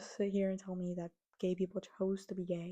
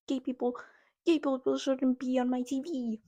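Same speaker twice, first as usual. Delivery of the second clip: higher, louder mock complaint; mocking homophobe; 5 semitones higher, 6 dB louder than usual. Vlogger mocking homophobes, higher whiny voice. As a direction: parody a whiny homophobic viewer.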